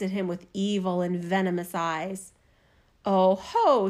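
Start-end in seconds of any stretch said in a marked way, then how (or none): 3.0-3.9 loud, gloating oh ho; mocking cry. A narrator's reading. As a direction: crow over the trapped prince. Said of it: "she cried mockingly".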